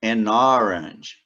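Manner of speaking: "an orange" slowed and linked, N into O; slow model phrase. Shown as a demonstration. Teacher slowly models "an orange" for students, linked. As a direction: link "an" straight into "orange". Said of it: "eu digo".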